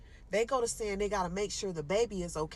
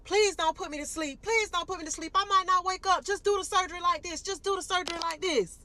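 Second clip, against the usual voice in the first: high, loud frightened pleading; patient begging not to be put to sleep; terrified begging; 11 semitones higher, 5 dB louder than usual. Retold or imitated. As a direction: beg them not to put you under. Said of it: "now I'm stressing".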